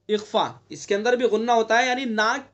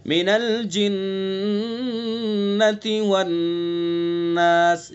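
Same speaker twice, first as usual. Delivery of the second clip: slow chanted recitation, खन्नास long; Quran recitation; 2 semitones lower, much slower than usual. Shown as a demonstration. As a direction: recite with खा full-mouthed. Recites the verse. (धीमी तिलावत)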